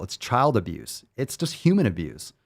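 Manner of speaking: ordinary delivery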